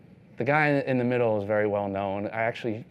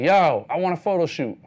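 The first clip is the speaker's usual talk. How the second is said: higher, louder eager request; he wants his photo taken now; excited stranger calling out; eager guy; 7 semitones higher, 7 dB louder than usual. Retold or imitated. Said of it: "He's like".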